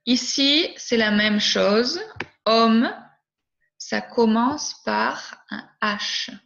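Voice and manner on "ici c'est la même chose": usual speaking voice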